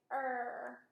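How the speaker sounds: "Er." with a bunched tongue, slow and exaggerated; modelled bunched R. Shown as a demonstration. Therapist modelling a bunched R for the child in the mirror. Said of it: "make your mouth look just like mine".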